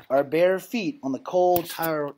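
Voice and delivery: ordinary delivery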